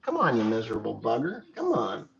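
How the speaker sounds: ordinary talk, nothing marked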